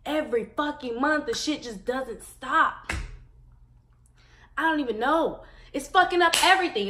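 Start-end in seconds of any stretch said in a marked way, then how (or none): 5.7-6.9 louder, higher, fast exasperation; fed-up shout; frustrated outburst. Real, not acted. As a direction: blurt it in frustration.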